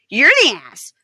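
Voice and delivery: mocking retort. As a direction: sneer it back like a kid.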